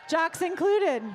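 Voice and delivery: loud, punchy call to the whole room; emphatic announcement. Real, not acted. Host telling everybody there's room for jocks, loud emphatic announcement. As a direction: call it out brightly to the crowd. Host rallies the crowd, then repeats it.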